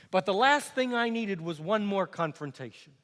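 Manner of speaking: usual speaking voice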